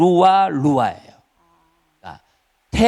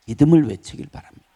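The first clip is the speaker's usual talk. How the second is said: ordinary talk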